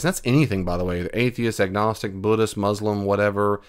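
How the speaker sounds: ordinary talk, nothing marked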